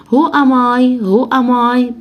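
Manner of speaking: usual speaking voice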